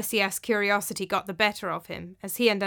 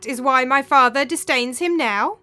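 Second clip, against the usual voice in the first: high, loud, incredulous outburst; loud, high-pitched bitter mockery; scornful dead wife; 6 semitones higher, 9 dB louder than usual. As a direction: throw out the accusation with rising indignation. Ghostly dead wife speaks.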